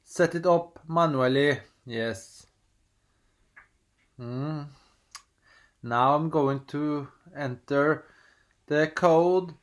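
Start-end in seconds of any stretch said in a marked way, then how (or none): none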